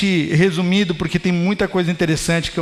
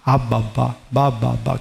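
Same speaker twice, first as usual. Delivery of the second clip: lower, a light imitation of baby babble; mild imitation of a baby babbling; 8 semitones lower, slower than usual. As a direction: mimic a toddler babbling its first word. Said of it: "ela começava a falar assim".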